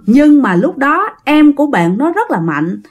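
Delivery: usual speaking voice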